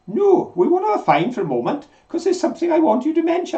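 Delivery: high-pitched, put-on voice of a co-host; mimicking Karen. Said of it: "And Karen said".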